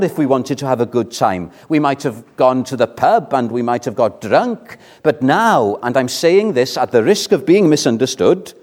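normal talking voice

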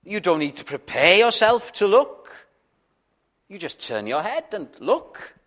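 impassioned appeal from the pulpit; fervent preaching. Acted. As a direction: press the question home to the congregation. Emphatic preaching climax.

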